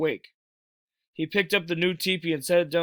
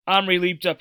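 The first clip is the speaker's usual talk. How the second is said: plain reading voice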